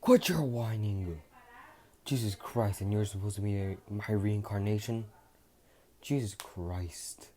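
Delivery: harsh scolding stranger voice, loud at first; Vegeta scolding. Acted. Vegeta scolds the crying hero, loud harsh voice. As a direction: bark it at a whiny boy. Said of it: "someone behind him saying".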